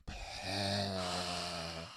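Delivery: long, low exhaled sigh. Real, not acted. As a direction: let out a drawn-out sigh. Long breath before resuming.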